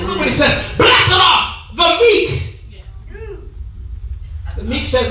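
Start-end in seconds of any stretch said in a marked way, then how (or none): 0.8-2.6 slow, drawn-out preached verse; preached scripture. Acted. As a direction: stretch each word out high and loud.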